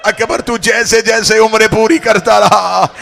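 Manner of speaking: building from steady to loud, high wail; zakir's rising mourning crescendo; preaching at full force. Acted. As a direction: climb line by line into a slow lament.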